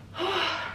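high exhausted sigh; tired sigh. Real, not acted. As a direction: let the burn out in a sigh. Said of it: "My abs are burning".

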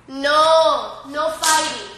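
sharp, loud command to dogs; loud scolding. Real, not acted. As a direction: shout it to stop the dogs. Breaking up dogs fighting.

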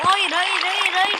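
loud repeated no; panicked shouting. Real, not acted. Panicking in game.

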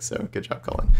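soft breathy aside; whispered. Real, not acted. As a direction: whisper it to a friend. Quiet aside to friend.

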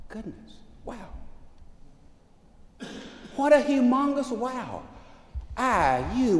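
normal talking voice

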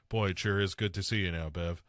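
emotionless, lifeless line reading; monotone bad acting. Host imitating adult Joe chatting with Bev, monotone. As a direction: mimic a wooden actor failing to sound playful. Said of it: "it's real monotone".

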